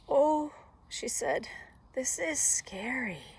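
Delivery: mildly frightened, high-pitched "oh"; scared little girl. A narrator's reading. Girl alone in the deepest woods, scared. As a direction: voice up, drawing out the fear.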